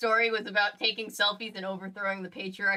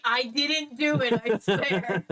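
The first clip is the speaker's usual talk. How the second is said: high, loud, drawn-out mock denial; mock protest; 4 semitones higher, 4 dB louder, much slower than usual. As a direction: protest innocence with exaggerated, laughing insistence. Imitating Natty's protest of innocence.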